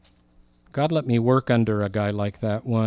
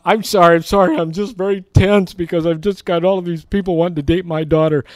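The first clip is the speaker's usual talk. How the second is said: flustered apology; 5 semitones higher, 6 dB louder, faster than usual. Retold or imitated.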